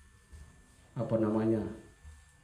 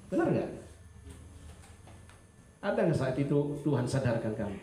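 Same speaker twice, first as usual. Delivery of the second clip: ordinary talk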